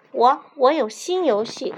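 loud, stumbling eager answer; eager blurt. Acted. Camelido eagerly offering a new game, excited blurt. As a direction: jump in before anyone else. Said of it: "争抢着回答".

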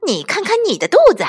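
fast, high-pitched excitement; excited cry. Acted. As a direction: rush it out in a high, eager voice. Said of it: "兴奋地叫道". (兴奋又急促地尖声叫道)

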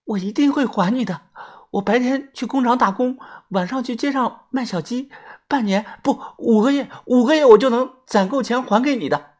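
a woman promises to repay him; earnest promise. A narrator's reading. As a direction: promise through the closed door. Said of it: "马凤凤在我身后说".

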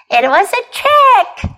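high, slow, singsong teasing without a laugh; gleeful teasing. Acted. Kipper owning up to Mom, gleeful teasing. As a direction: gloat playfully over the prank. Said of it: "laughed Kipper".

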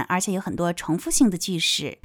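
normal talking voice

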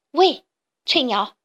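slow, loud hailing call; loud, drawn-out hail; loud shouting. A narrator's reading. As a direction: call out to get someone's attention. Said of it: "它大声嚷嚷".

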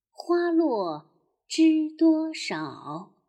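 一字一顿地慢慢吟诵古诗; 像给孩子示范背诗那样拖长节奏; 慢速诗歌朗诵. Acted. 第三遍朗诵唐诗. (缓慢地朗诵古诗)

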